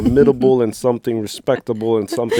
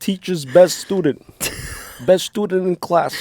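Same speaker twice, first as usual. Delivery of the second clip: mock teasing voice; 8 semitones higher than usual. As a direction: tease her like a pompous announcer. Continues teasing partner.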